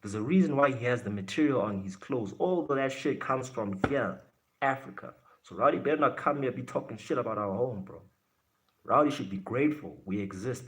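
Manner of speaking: ordinary talk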